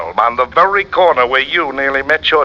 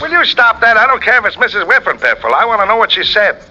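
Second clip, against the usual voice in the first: exasperated outburst; 8 semitones higher than usual. Acted. Sidekick fed up with the detective's corrections, exasperated outburst. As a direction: snap back at the nitpicking.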